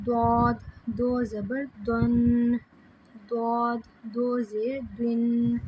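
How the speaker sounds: slow chant with دعاد said heavy and full-mouthed; full heavy dhad. Shown as a demonstration. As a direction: make دعاد thick and full, unlike the thin دال. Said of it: "دعاد کو پر کر کے پڑھتے ہیں". (دعاد کو پُر کر کے آہستہ پڑھنا)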